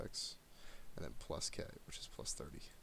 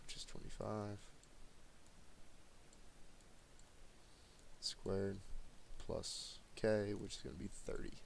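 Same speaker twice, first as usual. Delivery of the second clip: flat, tired monotone delivery; monotone voice of a tired teacher late at night; 4 dB quieter, slower than usual. Real, not acted. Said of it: "Sorry if my voice sounds super monotone. Tired".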